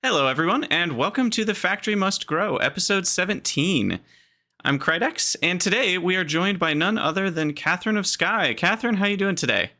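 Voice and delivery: bright, upbeat show opener; podcast host intro. Acted. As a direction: welcome listeners like a host. Opens the podcast episode.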